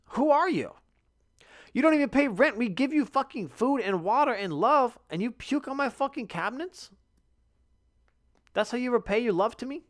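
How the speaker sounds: high-pitched, exasperated comic rant; mock indignant scolding. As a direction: scold your pet in hammed-up outrage.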